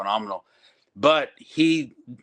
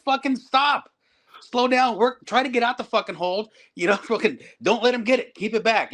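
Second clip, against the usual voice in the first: shouted coaching; 10 semitones higher than usual. Retold or imitated. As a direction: bark instructions at a rushing student. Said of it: "I'm like".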